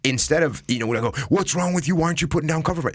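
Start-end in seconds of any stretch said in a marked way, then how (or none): 1.3-2.9 higher, pitched-up exasperated demand, half acted; imitating a partner snapping mid-firefight; mock angry yell on the radio. A firefighter partner yelling at his buddy, mock angry. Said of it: "yelling on the radio".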